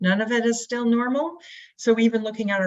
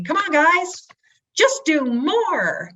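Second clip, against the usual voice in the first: high, loud, dragged-out pep-talk push; rally the team, stretching "just do more"; hammed-up cheerleading boss; pushy manager; 9 semitones higher, 4 dB louder, much slower than usual. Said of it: "you walk into your sales meeting".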